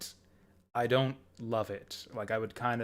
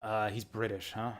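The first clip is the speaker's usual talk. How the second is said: ordinary talk, nothing marked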